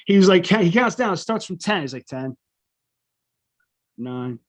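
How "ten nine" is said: soft, quiet mock countdown; hushed countdown. Friend counting down in study hall, quiet and low. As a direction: count it down under your breath.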